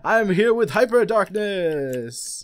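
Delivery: loud, high, drawn-out video opener; hyped intro. Real, not acted. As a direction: belt out a show welcome, stretching the key words. Host's show-opening greeting.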